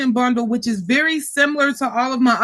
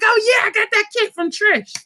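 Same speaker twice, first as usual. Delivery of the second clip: higher, breezy mock customer voice; imitating a customer; 8 semitones higher than usual. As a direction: voice a pleased buyer, pitched up.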